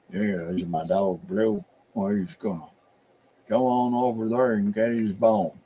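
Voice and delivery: low-key Appalachian hound owner; Appalachian accent. Acted. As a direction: talk about your dog in Appalachian. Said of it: "very Appalachian".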